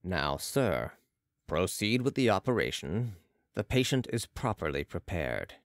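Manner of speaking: low, composed character voice; Balsamo directing. A narrator's reading. Balsamo tells the surgeon to proceed, low composed direction. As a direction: instruct calmly from deep down.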